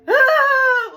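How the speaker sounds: high, loud, hammed-up crying wail; mock toddler wail. Imitating her loud crying at the officers in the cruiser. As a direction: wail like a toddler in the back seat. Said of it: "still crying like that".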